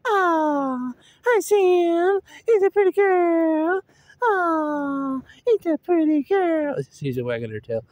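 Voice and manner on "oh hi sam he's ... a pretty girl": high, drawn-out gushing coo; cooing pet voice. Shown as a demonstration. Owner making the dog wag, high cooing pet voice. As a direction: gush over the dog in a high, slow squeal. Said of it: "had a voice like that".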